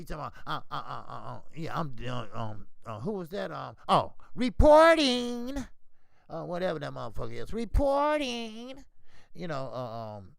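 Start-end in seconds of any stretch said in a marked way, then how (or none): 4.3-5.6 mocking drawl. Real, not acted. Mocking the reporter's name with a slow, loud drawl. 7.5-8.8 mocking drawl. Real, not acted. Mocking the reporter's name with a slow, loud drawl.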